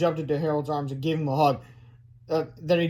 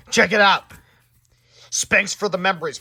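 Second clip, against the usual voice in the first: plain reading voice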